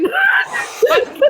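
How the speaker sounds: slow, laughing through words; laughter breaking the sentence; stifled giggles. Real, not acted.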